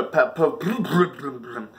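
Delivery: usual speaking voice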